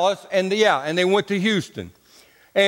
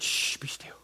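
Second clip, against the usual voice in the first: put-on whispered hush to a child; a whispered shush from a stern father; 13 dB quieter than usual. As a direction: hiss it under your breath in church. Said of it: "And he said".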